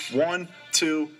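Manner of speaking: normal talking voice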